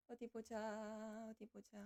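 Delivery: slow, fading mutter; muttering to self. Real, not acted. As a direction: think aloud quietly, trailing off. Muttering while fixing screen. (작게 잦아들며 혼잣말로)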